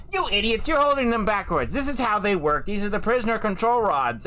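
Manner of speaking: high-pitched, exasperated know-it-all lecture; condescending. Acted. Eyes of the Tiger explains rods to magical users, condescending. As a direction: scold them as if they were fools. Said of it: "she's condescendingly explaining".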